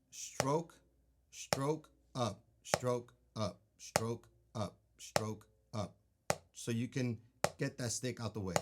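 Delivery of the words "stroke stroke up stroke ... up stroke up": "stroke" and "up" as separate beats, marking time; rhythmic practice count. Shown as a demonstration. Drum teacher calling a practice exercise, rhythmic and even. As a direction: say stroke and up in a steady, evenly spaced beat. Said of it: "Then practice that".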